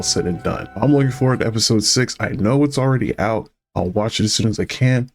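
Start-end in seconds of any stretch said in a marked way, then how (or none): none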